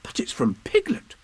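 put-on high-pitched boy's exclamation; surprised cry. A narrator's reading. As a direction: exclaim it as a startled little boy. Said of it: "cried Christopher Robin when he'd read it".

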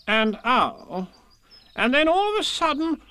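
high, insistent story-character voice; impatient Rabbit. A narrator's reading. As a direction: repeat it pointedly and press on. Rabbit echoes Pooh's interruption.

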